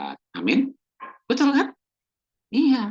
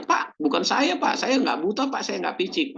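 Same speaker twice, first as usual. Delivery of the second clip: defensive denial; 11 semitones higher than usual. Retold or imitated. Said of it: "Lebih bagus kita ngatakan".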